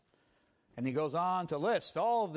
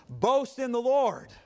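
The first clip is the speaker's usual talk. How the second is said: slow, louder, each word weighted; emphatic title; 8 semitones higher, 9 dB louder, slower than usual. Shown as a demonstration. Preacher proclaims his sermon title emphatically, slow and louder. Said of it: "I wanted to be emphatic".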